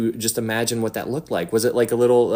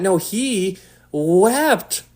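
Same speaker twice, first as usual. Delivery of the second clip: ordinary delivery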